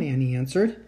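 usual speaking voice

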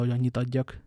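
ordinary delivery

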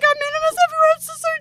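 high, loud, whimpering wail; overwrought, high-pitched pleading; hammed-up wailing apology. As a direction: wail an apology in a mock-crying squeal. Acted overwhelmed apology continues.

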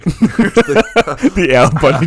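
slow, high, laughing through words. Real, not acted. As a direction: giggle out the line. Laughing at Al Bundy joke.

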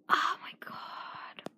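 slow horrified whisper. Real, not acted. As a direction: breathe it out in dread.

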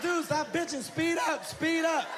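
friend yelling. Retold or imitated. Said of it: "He's like".

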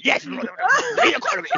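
full blustering politician impression; Boris Johnson. Host hamming up his slip into a politician, blustering impression. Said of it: "I went from Jackie to full Bojo".

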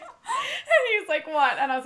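cracking up while quoting him; laughing. Retold or imitated. Retelling boyfriend's confused reply.

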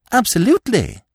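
beaming, high and bright exclaim; radiant cry. A narrator's reading. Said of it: "cried Freddy radiantly".

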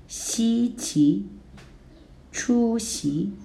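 插图, 稀奇, 出席 high level, then rising; first plus second tone. Shown as a demonstration. Teacher modelling 稀奇 for students, high then rising tone. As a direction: let 出席 climb on the second syllable. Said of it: "combine first and second tone".

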